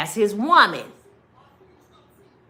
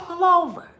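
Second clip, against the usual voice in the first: raised, higher calls to pull over; calling out; 7 semitones higher than usual. Retold or imitated. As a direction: yell it out the car window at the driver. Chasing car, calling to driver.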